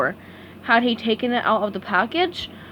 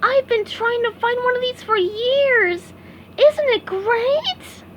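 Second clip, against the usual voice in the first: SpongeBob voice; 13 semitones higher, slower than usual. A narrator's reading. SpongeBob admiring the toy.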